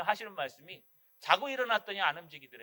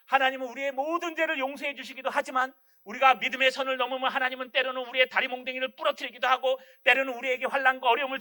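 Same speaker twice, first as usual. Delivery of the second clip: louder, driving sermon voice; fiery preaching; 3 semitones higher, 7 dB louder than usual. Acted. Pastor warning the congregation of God's discipline, fiery preaching. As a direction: pound each clause home to the congregation.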